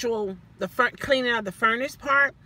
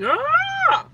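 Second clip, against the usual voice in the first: sudden high mock gasp of alarm; a shrill pretend gasp at the pictures; 3 dB louder than usual. Imitating alarm at the highlighted photos, high gasp.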